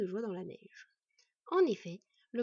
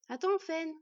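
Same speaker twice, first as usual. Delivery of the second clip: higher, louder call to stop; exclaiming; 7 semitones higher, 5 dB louder, slower than usual. Acted. Boy stopping the wolf, exclaiming. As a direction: call out urgently to halt. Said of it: "Bord s'exclama".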